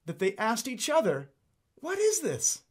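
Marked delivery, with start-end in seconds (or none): 1.8-2.6 high-pitched astonished question; amazed. Acted. People asking each other, amazed at the new teaching. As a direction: ask it in amazement, pitched right up. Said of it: "were all so amazed".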